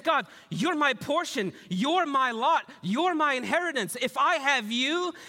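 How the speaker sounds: high, emphatic declaration to God; voicing the psalmist with full conviction; psalmist's declaration. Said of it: "This voice here is that good. He's saying".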